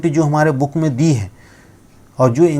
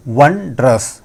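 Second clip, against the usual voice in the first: वंड्रस with वन quick and strong, falling through ड्र to अस; stress on first syllable; much slower than usual. Shown as a demonstration. Teacher shows students the stress in वंड्रस, falling. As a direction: hit वन, then let वंड्रस fall away. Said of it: "वन तेज बोलना है आपको और नीचे आते हुए".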